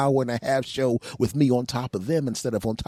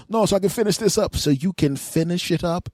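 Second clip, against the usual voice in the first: high quick voice; 9 semitones higher, 3 dB louder than usual. Retold or imitated. Lover and boyfriend exchange.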